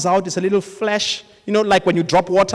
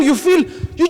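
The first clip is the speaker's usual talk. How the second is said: usual speaking voice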